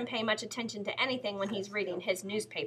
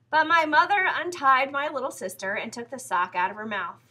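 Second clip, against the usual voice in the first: ordinary delivery, nothing marked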